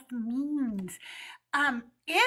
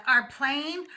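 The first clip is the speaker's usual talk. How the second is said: ordinary talk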